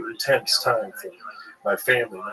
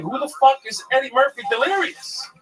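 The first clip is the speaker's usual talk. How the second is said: outraged confusion. Retold or imitated. Young comic who never heard of Eddie Murphy, bewildered. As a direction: squeal it in total disbelief. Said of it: "I was like".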